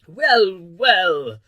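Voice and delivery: loud, high royal "well"; blustering king. A narrator's reading. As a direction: bluster loudly like a monarch. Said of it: "the king replied".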